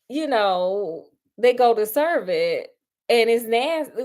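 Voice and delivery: usual speaking voice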